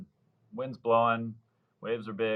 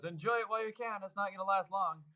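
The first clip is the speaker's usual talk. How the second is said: teasing buddy; 10 semitones higher, 5 dB quieter than usual. Retold or imitated. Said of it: "he runs over laughing".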